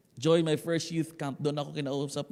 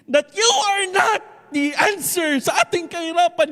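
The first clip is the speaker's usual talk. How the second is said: high, loud, impassioned cry; madamdaming pahayag ng ina; 14 semitones higher, 12 dB louder than usual. Acted. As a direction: proclaim it to your child at full force. Mother's emotional declaration to her son. (pasigaw na pahayag ng ina sa anak)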